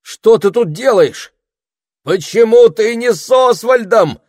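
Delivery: put-on high, loud alarmed boy's shout; a boy shouting in alarm at his friend. A narrator's reading. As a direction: yell it across the cave. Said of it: "закричал Саймон".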